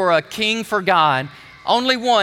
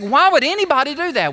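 raised, higher-pitched rhetorical protest; incredulous question; 7 semitones higher, 4 dB louder, faster than usual. Retold or imitated. Preacher voicing a listener appalled at the scourging, incredulous. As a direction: ask it in pressing disbelief.

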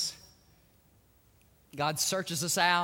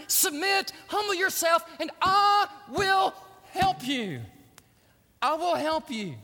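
high, loud, pleading voice of God; preaching at full force; 7 semitones higher, 4 dB louder than usual. Acted. God urging Hagar to return, loud impassioned preaching. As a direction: plead with her as God, rising to a climax.

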